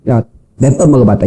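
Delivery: low, unimpressed imitation; jaded older woman. Older single woman on a date, unimpressed. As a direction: voice a woman who has seen it all. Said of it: "하나도 그냥 신기한 게 없는 거예요".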